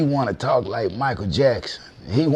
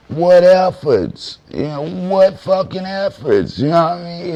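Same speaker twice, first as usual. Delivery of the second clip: loud outburst; 5 semitones higher, 7 dB louder than usual. Real, not acted. Ranting at the rapper's fans.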